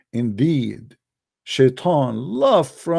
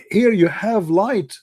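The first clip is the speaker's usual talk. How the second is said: usual speaking voice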